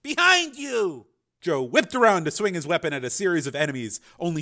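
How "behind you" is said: high, drawn-out alarm call; warning yell. A narrator's reading. Frank distracting his attacker, warning yell. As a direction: call out the danger. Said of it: "he yelled".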